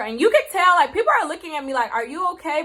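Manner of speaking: telling it in an excited rush; wide-eyed storytelling, voice jumping up; animated exclaim. Real, not acted. Animated retelling of stares.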